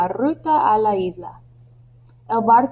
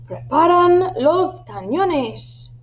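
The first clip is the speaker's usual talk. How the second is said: high, drawn-out captain's command; shouted order; 8 semitones higher, slower than usual. Acted. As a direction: call the order out across the ship. Captain orders cannons prepared. (orden gritada y alargada)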